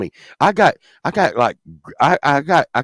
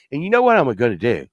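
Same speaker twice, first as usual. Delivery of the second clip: ordinary delivery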